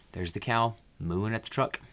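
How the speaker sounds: ordinary delivery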